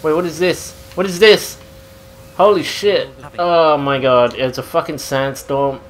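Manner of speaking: usual speaking voice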